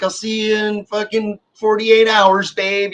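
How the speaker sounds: high, drawn-out farewell; imitated sing-out goodbye; called-out goodbye. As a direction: call after a departing partner, slowly. Acting out calling goodbye to partner.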